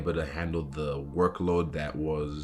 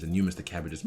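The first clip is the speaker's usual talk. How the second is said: ordinary talk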